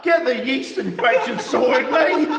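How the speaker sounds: high, loud, slow laughing jab; cheeky laughing dig at a mate. Real, not acted. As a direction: push the line out through laughter. Cracking up at his own joke.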